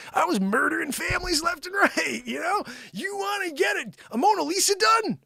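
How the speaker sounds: high-pitched indignant rant; hammed-up indignant boasting; mock outrage. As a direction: protest in a strained high voice. Mock-outraged boast of his deeds.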